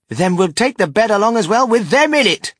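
high, loud, exuberant solution; bold, high-pitched enthusiasm; breezy insistence. A narrator's reading. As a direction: sweep the objection aside loudly. Wonka brushes objection aside.

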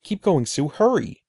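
frightened brother begging sister to hurry; breathless pleading. Acted. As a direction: push her on, panting and scared. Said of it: "Eddie pleaded, breathing hard".

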